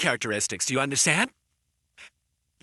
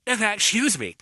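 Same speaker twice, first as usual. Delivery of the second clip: ordinary delivery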